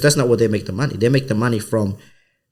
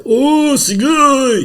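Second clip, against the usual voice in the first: high, loud Japanese exclamation of awe; hammed-up high-pitched amazement; amazed bystanders; 5 dB louder, much slower than usual. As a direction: imitate an onlooker crying out, impressed.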